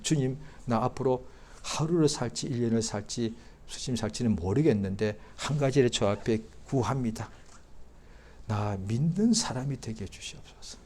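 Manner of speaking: softly spoken earnest prayer; quiet prayer. Acted. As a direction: pray it quietly and sincerely. Leads a prayer.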